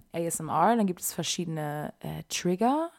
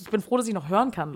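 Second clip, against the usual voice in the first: ordinary talk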